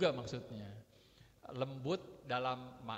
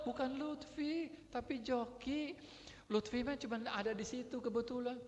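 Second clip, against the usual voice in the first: anak membela diri; 10 semitones higher than usual. Acted. Child protesting to scolding parent. (suara anak tinggi, memelas membela diri)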